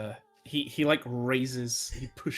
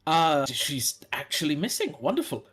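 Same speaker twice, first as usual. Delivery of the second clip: loud, tactless delight; 4 semitones higher, 9 dB louder than usual. Acted. Knight reacts to missing woman.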